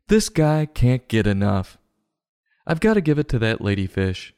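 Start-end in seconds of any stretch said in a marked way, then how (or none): none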